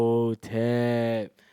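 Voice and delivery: mummy call. Retold or imitated. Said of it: "And I'm like".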